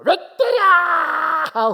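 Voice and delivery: very high, slow, loud wailing cry; crying baby. Retold or imitated. The revived baby cries at the slapping parents, loud wail.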